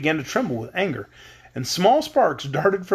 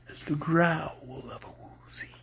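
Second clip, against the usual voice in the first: whisper; 8 dB quieter than usual. Acted. Woozy boasts of terrible growl.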